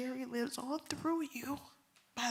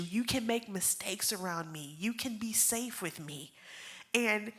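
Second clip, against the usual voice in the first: ordinary talk, nothing marked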